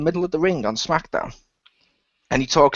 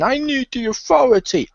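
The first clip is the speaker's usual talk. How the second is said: whiny mock; 9 semitones higher than usual. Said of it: "people who whine and complain".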